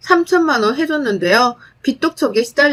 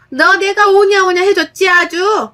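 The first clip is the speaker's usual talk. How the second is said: angry mother-in-law; 8 semitones higher, 4 dB louder than usual. A narrator's reading. Mother-in-law snaps back.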